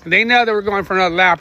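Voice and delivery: usual speaking voice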